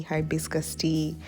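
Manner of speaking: ordinary talk, nothing marked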